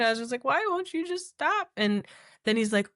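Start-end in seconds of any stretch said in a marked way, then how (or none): 0.4-1.7 high, drawn-out whine; high, slow, upset plea; retold tearful plea. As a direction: imitate begging through tears. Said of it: "I just start crying".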